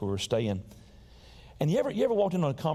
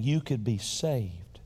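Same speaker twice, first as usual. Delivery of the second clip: usual speaking voice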